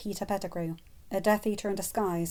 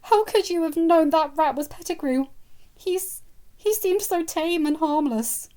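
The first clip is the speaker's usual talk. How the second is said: teary; 9 semitones higher, 7 dB louder than usual. Acted. Wife teary, consoled.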